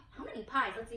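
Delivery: usual speaking voice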